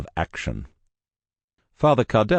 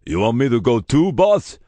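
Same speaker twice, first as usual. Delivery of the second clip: louder, rising question from the big man; giant's question; 7 semitones higher, 5 dB louder than usual. Acted. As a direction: ask eagerly, up at the end. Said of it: "the big man asked".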